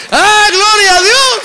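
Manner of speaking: shouted praise, high and loud; preaching at full force. Real, not acted. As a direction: cry out the praise to the congregation.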